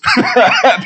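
a quick high laugh. Real, not acted. Pastor laughing at his own gym story, quick laugh. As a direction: laugh at your own punchline.

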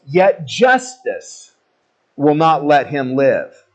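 drawn out, weighty pronouncement; slow solemn verdict. Retold or imitated. Islanders declaring justice will not spare him, slow and solemn. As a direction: lay each word down slowly like a verdict.

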